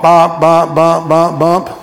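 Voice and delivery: loud honking bumps in the voice; loud mock car horn; imitated car horn. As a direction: honk like a car alarm.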